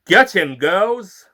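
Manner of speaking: loud, rallying order; battle command. Acted. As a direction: send the girls charging in. Narrator commands the flower girls.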